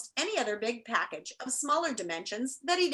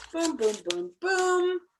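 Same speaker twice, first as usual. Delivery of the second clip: drumroll booms; 6 semitones higher, much slower than usual. Pretend. Finger landing sound.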